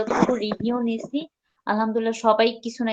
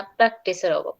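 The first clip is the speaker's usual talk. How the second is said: ordinary talk, nothing marked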